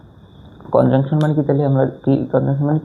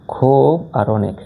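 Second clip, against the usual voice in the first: খুব আর অনেক spelled out slowly; slow right down so the class hears each word; unhurried teacher emphasis; slow and deliberate; much slower than usual. Shown as a demonstration. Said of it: "জোর দেওয়া বোঝায় না?".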